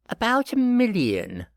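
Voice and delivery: put-on high cheeky boy voice; teasing boy. A narrator's reading. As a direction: tease him with a grin.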